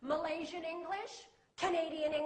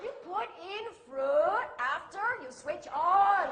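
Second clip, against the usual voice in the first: local English manager; 5 dB louder than usual. Retold or imitated. Manager repeating blender instructions, emphatic local English. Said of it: "He said".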